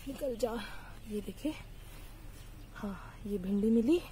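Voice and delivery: ordinary delivery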